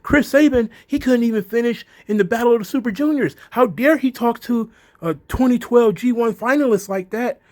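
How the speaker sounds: high, loud, indignant wrestling-nerd voice; nerdy Kenny Omega. Retold or imitated. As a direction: huff like an offended wrestling nerd. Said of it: "Kenny Omega got all, you know, wrestling nerdy".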